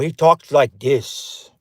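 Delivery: put-on slow Austrian-accented action-star voice; Arnold Schwarzenegger. Quiz host giving players a voice clue, Austrian-accented impression. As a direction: draw it out in a thick Austrian accent. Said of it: "who does that sound like".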